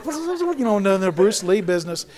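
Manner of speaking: lightly hammed-up imitation of a cocky brawler bragging; mock tough guy. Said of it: "He's like".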